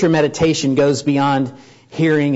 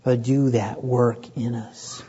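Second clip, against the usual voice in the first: slow, hushed whisper to God; whispered prayer; 2 semitones lower, 4 dB quieter, slower than usual. Real, not acted. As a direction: breathe the plea, barely voiced. Closing plea in prayer.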